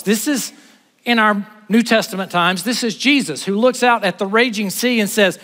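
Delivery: high, loud, building sermon climax; full-force preaching. Acted. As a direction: preach it with rising fire. Preaching at full force, Jesus calms sea.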